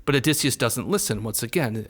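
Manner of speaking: usual speaking voice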